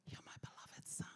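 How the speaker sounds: soft unvoiced whisper, barely there; hushed whispered declaration. Shown as a demonstration. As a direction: breathe it like a secret between father and son. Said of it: "he would have said it in a much louder way".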